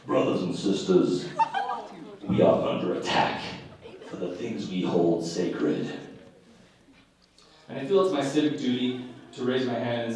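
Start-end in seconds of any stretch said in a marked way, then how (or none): 0.1-6.0 solemn church speaker. Retold or imitated. Mimicking a church member at a meeting, mock-grave and low. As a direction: ham up a grave warning from the pulpit. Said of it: "they'll say".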